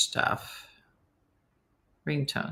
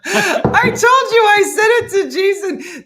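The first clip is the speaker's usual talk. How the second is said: words breaking up with laughter; laughing; 11 dB louder than usual. Real, not acted. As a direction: laugh through it, high and loud. Laughing while telling story.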